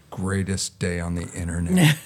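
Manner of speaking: deadpan. Retold or imitated. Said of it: "looked at me straight in the face".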